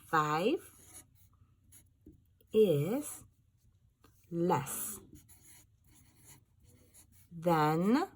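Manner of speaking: each word drawn out with pauses; slow dictation. Shown as a demonstration. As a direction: dictate word by word as you write. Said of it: "underline the word less".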